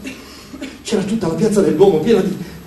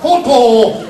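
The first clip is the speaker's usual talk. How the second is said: raised, chanted cooing syllables; verso da piccione; 4 semitones higher, 3 dB louder, much slower than usual. A narrator's reading. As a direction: repeat it like a cooing bird. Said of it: "diceva solo". (cantilena da piccione)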